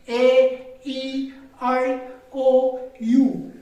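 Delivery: ए, ई, आई, ओ, यू drawn out one by one; slow, sustained ए, ई, आई, ओ, यू with lips never closing; open-mouthed vowels. Shown as a demonstration. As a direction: hold each of ए, ई, आई, ओ, यू open. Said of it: "हम एक ही मुंह से बोल सकते हैं".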